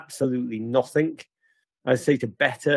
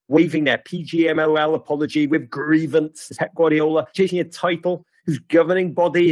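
usual speaking voice

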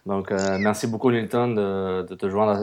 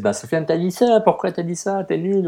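mock listener complaint; 9 semitones higher, 4 dB louder than usual. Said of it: "puis on dise".

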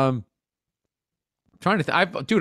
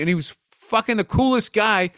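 ordinary talk, nothing marked

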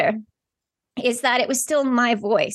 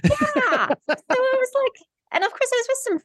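excited; 12 semitones higher than usual. Real, not acted. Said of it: "You're so excited".

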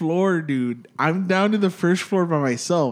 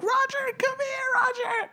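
much higher, frightened call of his name; imitating scared family; slower than usual. The frightened family calling Roger into the bedroom, much higher scared call. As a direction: mimic them begging him to come to them.

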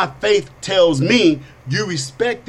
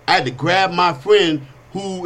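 normal talking voice

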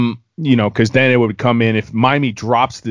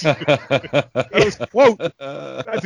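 laughing; 3 semitones higher, much slower than usual. Real, not acted. Laughing at the retold reaction.

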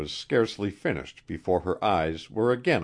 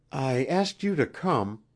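mildly nervous, higher voice; a bit nervous; 8 semitones higher than usual. A narrator's reading. Queen privately explaining her summons, a bit nervous. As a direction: begin with a touch of nerves. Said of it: "she began a bit nervously".

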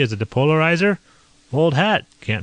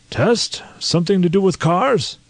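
puzzled colonel; 8 semitones higher than usual. A narrator's reading. Colonel puzzled, voiced character.

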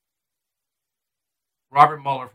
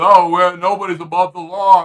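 lightly voiced prissy politician, a touch louder; mocking Nadler; 3 dB louder than usual. Host mocking Nadler's line about the law, sneering. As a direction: mimic him with a sneer. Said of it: "goes out and goes".

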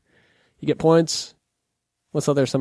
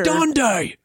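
higher-pitched Aussie interjection; mock Australian accent; 5 semitones higher than usual. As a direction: interrupt in silly fake Australian.

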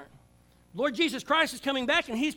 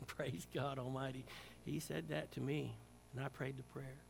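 1 hushed testimony; 9 semitones lower, 11 dB quieter than usual. Real, not acted. Tender testimony of God's whisper.

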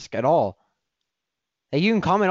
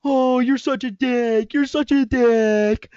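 higher, louder, slow drawn-out mock voice; mocking her friends; 7 semitones higher, 6 dB louder, slower than usual. Her friends texting him insults, mocking drawn-out whine. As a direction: stretch it out like a sneering, whiny friend. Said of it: "her fucking friends texting me being like".

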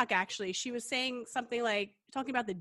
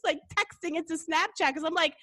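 ordinary delivery

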